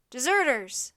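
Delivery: loud cry of alarm; shouted accusation. A narrator's reading. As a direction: shout it across the camp. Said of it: "someone shouted".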